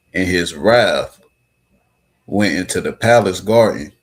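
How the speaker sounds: bold, loud declaiming of the verses; loud dramatic scripture reading. Real, not acted. Reading the king's wrath aloud to the group, loud and forceful. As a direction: read it out big, like a proclamation. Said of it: "Read that again".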